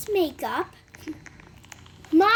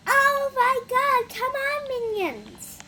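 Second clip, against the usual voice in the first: mock bossy classmate; 8 semitones higher, slower than usual. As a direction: imitate a queen bee summoning followers, high-pitched. Mimics classmate summoning her minions.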